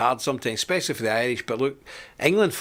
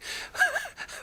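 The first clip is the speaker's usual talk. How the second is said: high squeaky laugh; 8 dB quieter than usual. Real, not acted. Laughs at own joke.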